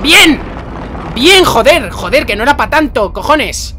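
high, loud cry of triumph, easing off; victorious sweary yell, settling gradually; exultant relief. Real, not acted. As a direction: shout in relieved exasperation. Relief after passing hard section.

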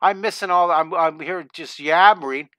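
ordinary delivery

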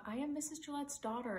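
plain reading voice

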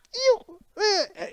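very high squeaky ew of disgust; high-pitched disgust. Retold or imitated. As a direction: imitate a squeamish actress recoiling. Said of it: "really high-pitched, like, noises".

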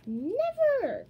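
loud, high drawn-out yell; full-voiced high retort; defiant shout. A narrator's reading. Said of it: "Kirsty shouted back".

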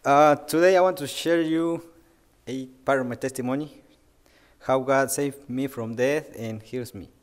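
ordinary talk, nothing marked

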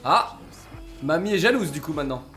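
ordinary talk

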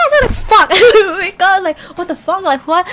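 high, loud retold shock, breaking into laughter; startled outburst. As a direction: act out being jolted awake. Retells waking to a scream.

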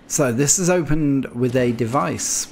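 ordinary delivery

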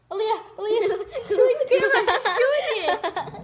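loud, high, laughing exclamation. Real, not acted. Mother laughs as the baby chews the camera, loud high laughter. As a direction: crack up as you say it.

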